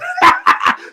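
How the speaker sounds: high burst of whooping laughter; loud laughing shout. Real, not acted. A vlogger cracking up over the deleted video, whooping laugh.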